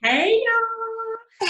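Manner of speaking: high, bright hello to the crowd; excited greeting. Real, not acted. Guest greeting the host's audience, high excited hello. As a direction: sing out a hello to everyone.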